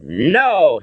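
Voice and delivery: high, loud shout. A narrator's reading. Father protests to his son, shouting. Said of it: "Henry shouted".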